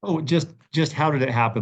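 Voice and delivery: usual speaking voice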